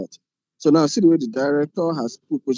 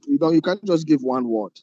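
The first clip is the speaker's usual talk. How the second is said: ordinary talk, nothing marked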